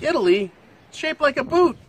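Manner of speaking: high, loud, dumbfounded take on a naive American; mock clueless. Mocking a clueless listener's reply, high and loud. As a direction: play the clueless guy who only knows the boot shape. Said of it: "and you're like".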